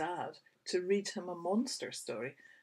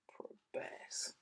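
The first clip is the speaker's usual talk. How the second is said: whisper; 10 dB quieter than usual. Acted. Whispered pity.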